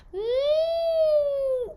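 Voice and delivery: tight, pinched hum very high and loud; squeezed high hum. Shown as a demonstration. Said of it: "and everything gets squeezing".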